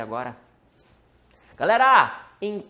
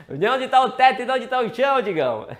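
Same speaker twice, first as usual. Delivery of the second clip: laughing question; 7 semitones higher, 10 dB louder than usual. Real, not acted. Teasing question through laughter.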